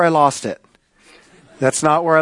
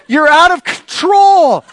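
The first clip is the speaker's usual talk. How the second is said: high, loud, drawn-out mock reproach; scolding wife; 10 semitones higher, 5 dB louder, slower than usual. Wife to raging husband on the concourse, mock scolding. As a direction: imitate a wife's slow, loud scolding.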